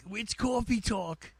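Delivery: lightly doing the SNL Coffee Talk voice; mild northeastern "coffee" with a long aw; coffee talk bit. Retold or imitated. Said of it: "Saturday Night Live bit".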